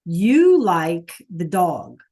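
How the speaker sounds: slow down and hit "you" hard; stress on you. Shown as a demonstration. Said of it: "we're emphasizing".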